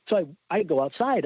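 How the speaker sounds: ordinary talk, nothing marked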